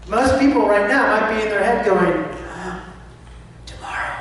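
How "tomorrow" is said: breathless, voiceless panicked whisper; acted fear. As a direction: gasp it out softly as an imitation of anxious thoughts. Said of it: "Right, that fear, that's what it sounds like".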